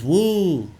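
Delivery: loud, high mock "woo!"; imitated scream. Imitating the driver as she loses control, mock scream. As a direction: mimic her whooped scream, pitch swooping up.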